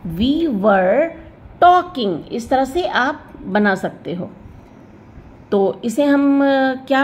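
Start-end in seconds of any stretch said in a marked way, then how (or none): 0.1-2.3 slow, rising on talking; teacher recap; slow example. Shown as a demonstration. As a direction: repeat it slowly. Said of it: "क्या होगा?".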